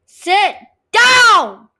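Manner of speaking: furious high shout; raging yell. Real, not acted. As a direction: scream it at him in rage. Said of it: "Starting to rage".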